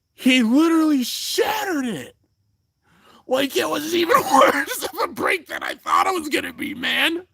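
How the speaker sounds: high-pitched excited shout; shrieking amazement at the top of the voice; amazed shouting. Real, not acted. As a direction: cry it out high, overcome with disbelief. Amazed retelling of the shattering.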